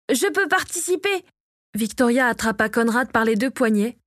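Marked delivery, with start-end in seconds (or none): 0.1-1.2 high-pitched eager offer; volunteer in a raised pitch; eager call. A narrator's reading. Kyo offers to take part, eagerly calling out. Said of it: "lança Kyo".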